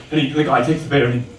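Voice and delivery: ordinary talk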